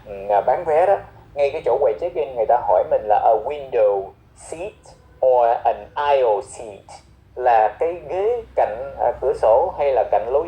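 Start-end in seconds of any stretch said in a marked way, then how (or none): 3.5-6.8 slow, drawn-out agent question; slow model question. Shown as a demonstration. Teacher modelling the seat question slowly for the class. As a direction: stretch each word for learners. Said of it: "cái từ này đọc là các bạn aisle".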